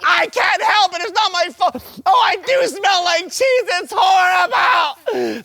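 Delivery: wailing and half-shouting, hammed-up tears; mock crying meltdown. Shown as a demonstration. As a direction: play the hurt victim, bawling it out. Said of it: "that's one way to react".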